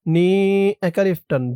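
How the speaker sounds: ordinary delivery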